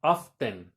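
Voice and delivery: "often" low, as OFF-ten with a clear T; pronounced T. Shown as a demonstration. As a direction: sound the T in "often", dropping the pitch. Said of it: "a veces lo pronuncian como often".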